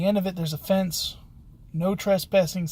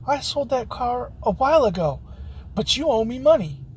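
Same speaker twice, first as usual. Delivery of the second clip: retold accusation, high and loud; mock-indignant, pitched up and louder; 6 semitones higher, 3 dB louder than usual. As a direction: imitate an aggrieved customer demanding payment. Woman indignantly demands money from him.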